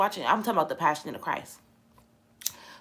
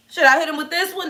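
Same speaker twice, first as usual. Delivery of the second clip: taunting soldier; 9 semitones higher, 4 dB louder, faster than usual. Retold or imitated. Soldier mocking crowd.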